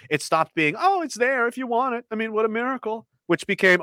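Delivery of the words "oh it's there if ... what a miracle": high, singsong sarcasm; mock the generous offer, eyes rolling; lightly sarcastic sweetness. Mocking the government's offer.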